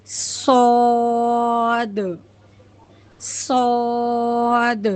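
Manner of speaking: صاد very slow, whistled with rounded lips; heavy saad demo. Shown as a demonstration. Teacher shows the heavy rounded saad, slowly stretched. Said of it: "منہ کو گول کر کے".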